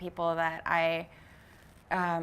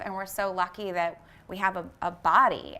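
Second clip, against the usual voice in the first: usual speaking voice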